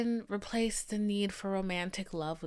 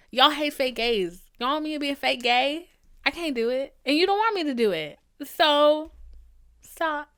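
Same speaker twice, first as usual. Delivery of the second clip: louder and higher, picking up speed, fed up; exasperated rant; 6 semitones higher, 8 dB louder than usual. Real, not acted. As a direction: tell them off, done with it. Ranting at advice-givers.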